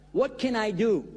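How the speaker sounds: ordinary delivery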